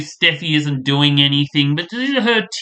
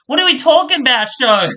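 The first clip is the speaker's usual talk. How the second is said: exasperated outburst; 10 semitones higher, 5 dB louder, faster than usual. Real, not acted. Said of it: "Like, what the hell?".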